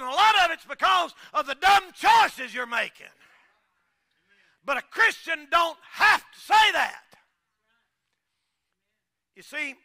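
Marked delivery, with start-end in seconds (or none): 0.0-7.0 loud, high, rising preacher's cry; shouting sermon climax; preaching at full force. Acted. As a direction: preach it hard to the congregation.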